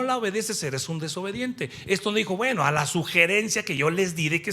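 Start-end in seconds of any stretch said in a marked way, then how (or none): none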